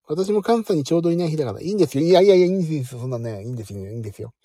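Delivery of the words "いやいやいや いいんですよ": loud, insistent no-no-no; louder protest that it's fine; emphatic refusal. Real, not acted. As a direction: wave it off loudly, higher. Humbly waving off thanks.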